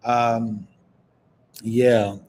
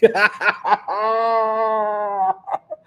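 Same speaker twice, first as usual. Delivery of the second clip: high laugh; 8 semitones higher than usual. Real, not acted. Laughs after snake demo.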